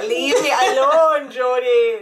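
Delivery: loud, drawn-out wail through laughter; mock wailing plea. Imitating friend fleeing a hug, loud mock wail.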